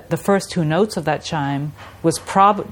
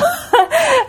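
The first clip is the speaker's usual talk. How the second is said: high laugh; 4 dB louder than usual. Real, not acted. Laughing at the memory.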